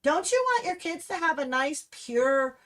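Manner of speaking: normal talking voice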